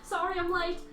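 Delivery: high, loud British latecomer; British accent. Acted. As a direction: apologize brightly in a put-on British accent. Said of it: "I wanna give her a British accent".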